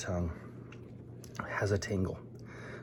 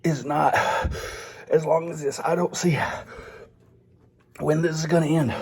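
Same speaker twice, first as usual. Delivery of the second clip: talking through spicy pain; 5 semitones higher than usual. Real, not acted. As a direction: push the words out through real pepper pain. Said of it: "I'm gonna try to get through a few sentences".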